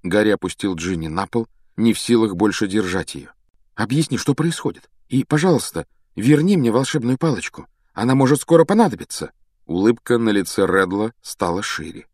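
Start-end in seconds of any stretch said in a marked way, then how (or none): 3.8-9.3 higher, urgent demand; anxious insistence. A narrator's reading. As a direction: press him for answers. Harry demands wand and explanation. (взволнованно и настойчиво)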